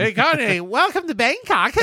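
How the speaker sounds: high, sing-song mock welcome; high mock-cheery hello; grating greeting voice. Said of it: "in that voice".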